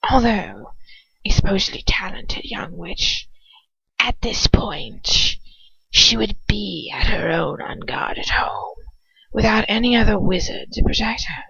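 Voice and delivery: cold hissing whisper of a dark lord; Voldemort whisper. Acted. Voldemort deciding who to send, in a cold whisper.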